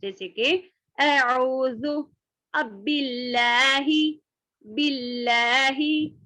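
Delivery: slow, light बिल्लाही with a doubled l; thin light बिल्लाही. Shown as a demonstration. Teacher modelling Quran recitation for students, slow and light. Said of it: "इसको बारीक पढ़ेंगे".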